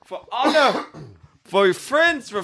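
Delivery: rally the troops, half in jest; hammed-up king's address; rousing movie speech. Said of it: "I know this whole speech".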